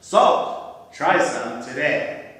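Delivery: loud infomercial announcer imitation; deadpan announcer. Imitating a funny friend's salt infomercial, deadpan announcer's voice. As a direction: punch out the product name like a pitchman. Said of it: "He'd have this deadpan announcer's voice".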